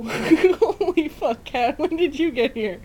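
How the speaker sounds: loud, high startled cry at the cat; loud high startled shout. Real, not acted. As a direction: jump at the cat.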